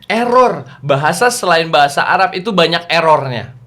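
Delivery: ordinary delivery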